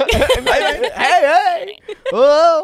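high, loud cheery hey; match the fan's energy; bright shouted hello, laughing; excited greeting. Retold or imitated. Dale greeting fan, acted.

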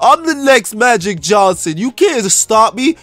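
excited showing off. Acted. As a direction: call out to Mom and coach, boasting. Showing off to mom and coach.

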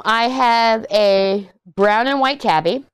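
ordinary delivery

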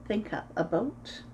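plain reading voice

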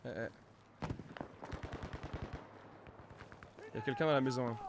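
hushed, wary; low voice, sneaking up; whispering. Real, not acted. Stalking an enemy quietly. (à voix basse)

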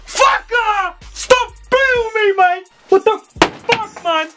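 high-pitched yelling rant; outraged shout. Real, not acted. Ranting shout at the footballer. (woedend hoog geschreeuw)

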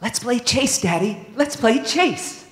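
put-on high, eager little-kid voice calling Daddy; child begging to play. Pup pleading with Daddy to play chase, high and eager. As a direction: beg like a small child wanting a game.

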